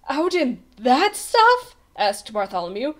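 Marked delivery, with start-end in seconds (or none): 0.1-1.8 slow, high, loud disbelief; incredulous question. A narrator's reading. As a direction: question the king in dismay. Page boy doubts the king's order.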